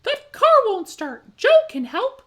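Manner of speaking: high, loud urgent puppy cry; excited yelp. A narrator's reading. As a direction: yelp it out like an eager pup. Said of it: "cries out a yelp".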